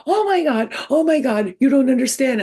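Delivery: high, loud imitation of being overwhelmed; overwhelmed outburst. Client finally allowed to talk after the reading, imitated overwhelmed outburst. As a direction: mimic someone bursting with astonishment.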